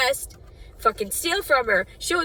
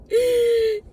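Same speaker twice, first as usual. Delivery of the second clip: sharp high crying gasp; a gasp torn out by sobbing; 13 semitones higher, 5 dB louder than usual. Real, not acted. Grieving her dog, gasping sob.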